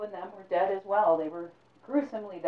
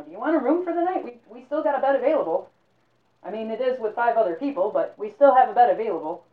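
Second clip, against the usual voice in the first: innkeeper voice; 5 semitones higher, 10 dB louder than usual. Acted. Innkeeper offering a room.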